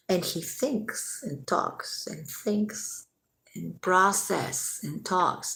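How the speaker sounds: slow, deliberate. Retold or imitated. Defending his slow way of talking, slow and deliberate. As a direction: draw it out softly, pausing to process. Showing how slowly he talks.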